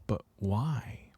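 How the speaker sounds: breathless whispered but why, unvoiced. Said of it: "it's like".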